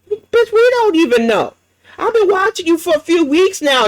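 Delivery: high, incredulous outburst; exasperated outburst. Real, not acted. Host reacting to the streamer's question, exasperated and high. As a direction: burst out in disbelief.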